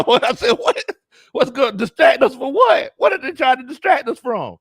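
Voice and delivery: high, loud comic disbelief; hammed-up bewildered outburst; incredulous shouting. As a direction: demand answers at a yell. Incredulous reply to cousin, acted.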